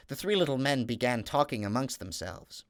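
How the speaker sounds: usual speaking voice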